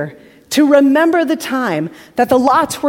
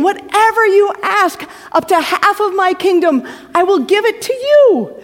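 higher, eager, fawning king; doting king; 8 semitones higher than usual. Acted. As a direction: offer her the world, all too keen. Doting king addresses beloved Esther.

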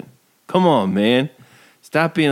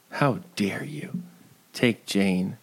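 an unvoiced whispered rebuke; 3 semitones lower, 7 dB quieter than usual. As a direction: whisper it hoarsely, no voice. Scolding the character twice.

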